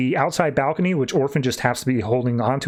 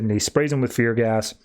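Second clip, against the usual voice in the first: ordinary talk, nothing marked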